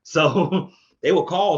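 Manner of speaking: laughing. Real, not acted. Laughing while retelling calls.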